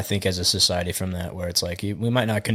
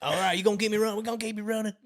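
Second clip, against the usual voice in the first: high, softer country twang; mock Southern accent; 12 semitones higher, 4 dB quieter, much faster than usual. Friend replaying keep-you-running exchange, high Southern twang. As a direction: imitate a Southern customer, pitched way up. Parody of Southern tank call.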